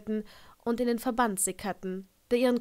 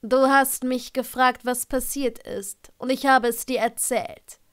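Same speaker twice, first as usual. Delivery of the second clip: irritated Severus; 3 semitones higher, 8 dB louder than usual. A narrator's reading. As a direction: push back irritably, word by word. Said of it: "starrte sie gereizt an".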